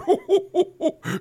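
big jolly ho-ho laugh, high and loud; belly-laugh like Santa. Acted. Santa laughing to the child in the commercial, jolly ho-ho. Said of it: "doing Santa Claus".

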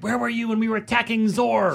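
high-pitched mock outrage; hateful gamer message. Angry teammates messaging Mila about missed missions, mock hateful. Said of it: "these, like, hateful, like, messages".

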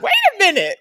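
high, loud playful shout; mock shout. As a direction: call out in mock alarm. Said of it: "And I was like".

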